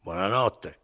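rude, short goodnight; curt goodnight. Acted. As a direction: bark it and leave. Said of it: "gli disse sgarbatamente".

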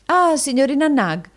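high, loud greeting; brisk exclamation of recognition; hailing. A narrator's reading. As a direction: call out to the forewoman. Madam hails the forewoman.

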